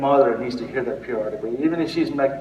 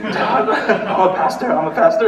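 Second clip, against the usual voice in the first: high, loud mock pleading through laughter; laughing self-restraint; 7 semitones higher, 7 dB louder than usual. Pastor provoked by his mother reminds himself, laughing self-restraint. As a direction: chant it to yourself while cracking up.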